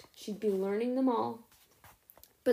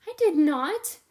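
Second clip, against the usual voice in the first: high, louder indignant protest; protesting denial; 7 semitones higher, 8 dB louder, slower than usual. Acted. Sophie protests to Dex that she didn't light up, indignant. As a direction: fire back a flat denial.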